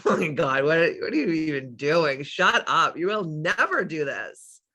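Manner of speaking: a hint of a scoffing voice in her head; mocking inner voice. Her self-doubt mocks her morning affirmations, lightly sneering. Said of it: "little voice in the back of my head that's, like, laughing at me".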